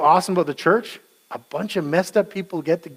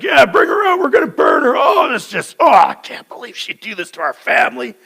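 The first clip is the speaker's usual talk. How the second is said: much higher, loud crying rant; hysterical outrage; 7 semitones higher, 5 dB louder than usual. Retold or imitated. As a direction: wail and shout in mock outrage. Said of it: "Judy's like".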